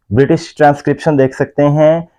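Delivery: usual speaking voice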